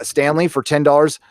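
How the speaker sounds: normal talking voice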